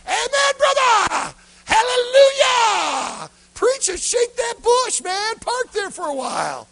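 high, drawn-out revival shout; hammed-up congregation cheering at full volume; hollered amens. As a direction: holler encouragement at the preacher, stretching the words. Acting out cheering on the preacher.

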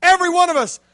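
fiery youth-pastor preaching; full-force preaching. Acted. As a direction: drive the story home to the crowd.

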